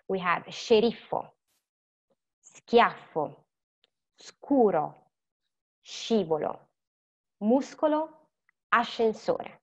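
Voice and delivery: careful teacher demonstration; Italian pronunciation drill. Shown as a demonstration. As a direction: model the Italian words clearly for students. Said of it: "decide where these words go".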